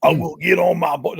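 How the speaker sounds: a hint of a New Jersey accent; lightly put-on Jersey accent. Repeating the boater line in a Jersey accent. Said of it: "that's a perfect Jersey accent".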